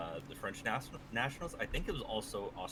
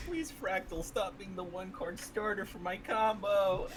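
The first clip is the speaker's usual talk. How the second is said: mock pleading; 11 semitones higher, 5 dB louder, slower than usual. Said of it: "joining the bit".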